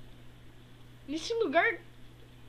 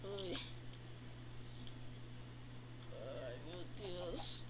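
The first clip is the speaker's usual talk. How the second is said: faint, woozy and much softer; barely conscious, dizzy murmur; dizzy and groggy; 8 semitones lower, 10 dB quieter, slower than usual. Acted. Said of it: "eu tô tonto". (tonto e grogue, bem baixinho)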